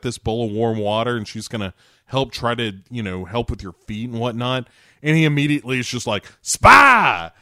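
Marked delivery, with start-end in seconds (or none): 6.4-7.3 loud, high mock-paranoid yell; explosive one-word cry; sudden accusing shout. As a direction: bellow the accusation out of nowhere. Acting character's sudden accusation.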